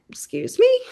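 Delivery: high incredulous excuse me; shocked disbelief. Retold or imitated. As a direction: squeak it out in disbelief. Seller confronts her, finger in face.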